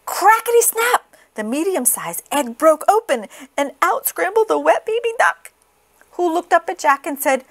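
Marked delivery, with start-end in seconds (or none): none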